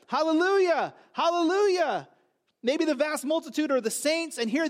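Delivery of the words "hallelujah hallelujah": ringing, loud, high hallelujahs; exultant hallelujahs. Acted. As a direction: cry hallelujah like the heavenly choir. Preacher echoing heavenly praise.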